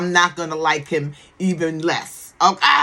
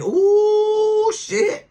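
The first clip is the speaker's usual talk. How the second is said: long, high swooping ooh; exaggerated uh-oh alarm, pitched way up; mock shocked ooh; 14 semitones higher, 4 dB louder than usual. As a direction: drag out a scandalized reaction. Retold reaction to child's revelation.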